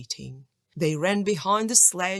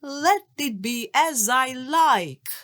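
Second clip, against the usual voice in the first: louder, higher magic command; shouted spell; 6 semitones higher, 6 dB louder than usual. A narrator's reading. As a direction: call out the charm with force. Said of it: "and shouted".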